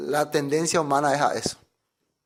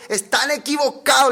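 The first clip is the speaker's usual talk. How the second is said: raised, urgent rebuke in Paul's voice; mock shout; 7 semitones higher than usual. Paul rebukes the crowd worshipping him, mock shout. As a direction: cry out a mild mock warning. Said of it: "le está gritando".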